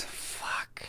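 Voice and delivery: whispered curse under breath. Real, not acted. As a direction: whisper it to yourself. Stream found, hushed curse.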